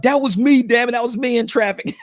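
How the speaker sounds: high, excited and laughing; mock the driver realizing she was seen; high exclaim breaking into laughter; laughing recognition. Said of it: "They'd be like".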